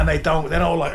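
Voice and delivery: ordinary talk, nothing marked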